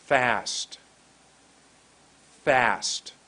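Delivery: FAST forced out on a hard burst of air; FAST hit hard at the beginning, then falling. Shown as a demonstration. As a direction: punch out FAST, air pushed hard from the first sound. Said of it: "So here's what it does".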